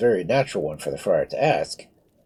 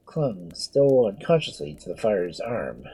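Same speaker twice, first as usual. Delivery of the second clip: ordinary delivery, nothing marked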